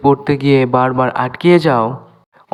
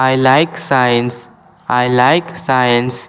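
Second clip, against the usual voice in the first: slow, clear English for learners; careful dictation pace; slow teaching demo; much slower than usual. Shown as a demonstration. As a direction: spell each word out slowly for students. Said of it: "এখানে SK উচ্চারণ করা হয় না".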